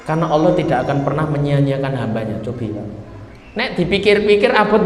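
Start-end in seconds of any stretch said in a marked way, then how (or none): 0.0-2.4 softer, reassuring wife; calm, relieved Hajar. Acted. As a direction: gently reassure your husband. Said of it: "tiba-tiba sumringah". (Hajar menenangkan suaminya dengan lembut)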